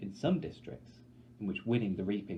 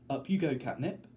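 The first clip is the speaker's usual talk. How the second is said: forced steady voice; 5 semitones higher, 5 dB louder than usual. Acted. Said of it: "a voice he's fighting to keep steady".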